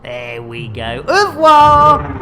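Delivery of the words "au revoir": loud, high, hammed-up French goodbye; mock French farewell. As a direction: sing it out like a theatrical Frenchman.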